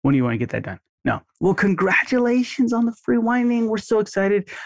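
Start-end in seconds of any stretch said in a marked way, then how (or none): 1.4-4.5 higher, excited and upbeat; cheerful caller making the free whitening a big deal. Shown as a demonstration. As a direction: make the congratulations sound like big news. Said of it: "Make it a big deal".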